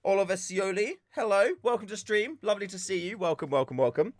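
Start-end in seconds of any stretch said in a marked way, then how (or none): none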